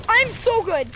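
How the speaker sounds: high, loud thrilled bragging; excited yelling. Real, not acted. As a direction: shout in triumph. Celebrating a big play.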